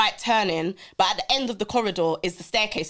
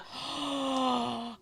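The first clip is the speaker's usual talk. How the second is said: gasp; 4 semitones higher, 10 dB quieter than usual. Retold or imitated. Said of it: "She was like".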